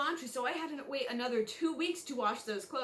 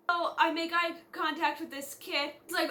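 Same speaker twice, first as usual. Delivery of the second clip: ordinary talk, nothing marked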